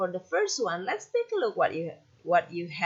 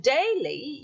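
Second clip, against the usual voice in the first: "daily" slowly, with DAI stressed and LY light; front stress; 6 semitones higher than usual. Shown as a demonstration. Teacher explaining stress at the front, slow front stress on "daily". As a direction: draw out the first syllable of "daily". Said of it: "you give stress at the front".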